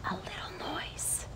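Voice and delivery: very soft breathy whisper. A narrator's reading. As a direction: barely breathe the words. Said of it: "whispered the rabbit".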